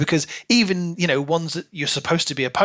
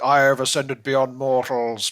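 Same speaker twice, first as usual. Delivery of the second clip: a lightly voiced grand proclamation; mock the lofty boast of a self-made god; pompous god. Podcast host mocking a character's boast, lightly grandiose.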